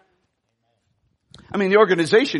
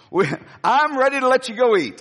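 ordinary talk, nothing marked

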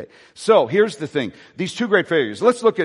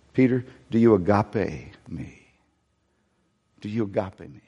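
тихий проникновенный вопрос; soft earnest question; 6 semitones lower, 3 dB quieter than usual. Acted. Jesus asks third time.

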